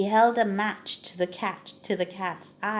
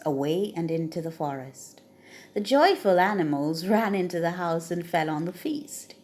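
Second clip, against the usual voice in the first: usual speaking voice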